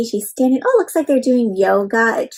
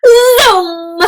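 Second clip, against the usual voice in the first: excited zoom; 7 semitones higher, 5 dB louder than usual. Acted. Mina explodes.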